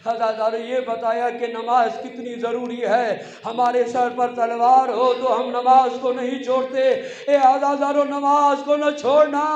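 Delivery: impassioned majlis recitation; preaching at full force. Acted. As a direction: build the sermon up to a loud lament.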